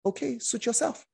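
light, high, shrugging okay; easy-going Jesus. Retold or imitated. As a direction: let him go with a casual shrug. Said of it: "Jesus said".

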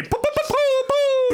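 high, mocking poo-poo chant; mock gibberish. Mocking a friend's nonsense answer in a high squeak. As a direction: parrot his nonsense back in a squeaky voice. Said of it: "Are you just going to say".

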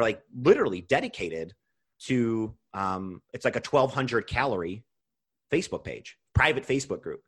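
ordinary talk, nothing marked

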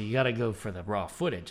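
ordinary talk, nothing marked

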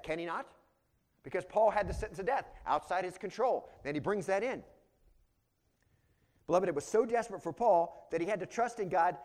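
forceful preaching. Real, not acted. Preacher tells the beloved of Paul's desperate trust in God, forceful preaching. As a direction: press each point on the congregation.

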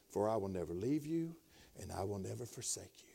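low, soft, near whisper; hushed promise. Retold or imitated. Preacher recalls God speaking to him in the truck, soft. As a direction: breathe the promise gently.

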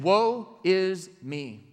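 very slow, drawn-out woe; slow lament. Retold or imitated. Isaiah confessing before God, slow lament. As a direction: stretch each word in dread.

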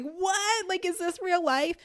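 high-pitched, thrilled disbelief at normal volume; excited disbelief. Retold or imitated. Couple jumping up and down at the good news, excited disbelief. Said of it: "we were so excited".

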